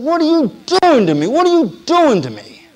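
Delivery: high, loud anguished cry of protest; desperate high plea, voice straining; angry crying out to God. Retold or imitated. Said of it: "I was angry".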